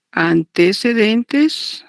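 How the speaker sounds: ordinary talk, nothing marked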